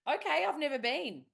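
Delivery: high, retold polite bafflement; bemused reply. Teacher replying to a mistaken client, high and bemused.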